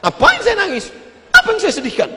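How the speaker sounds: high-pitched protesting question; indignant retort. Acted. Pilgrim asked why he doesn't cry, retorting indignantly. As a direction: throw it back in a high, incredulous voice.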